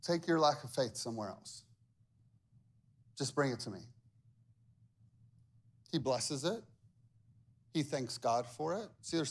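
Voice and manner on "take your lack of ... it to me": low, soft, brisk dismissal; calm Lord. Retold or imitated. Jesus coolly telling disciples to bring the food, low. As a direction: wave it off quietly. Lord's reply voiced.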